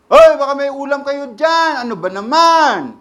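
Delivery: hollering next door for food; calling out. Retold or imitated. Preacher plays someone yelling to the neighbor for viand, loud call.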